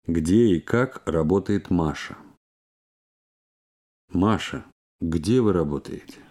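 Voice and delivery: normal talking voice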